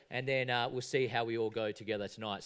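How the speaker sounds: usual speaking voice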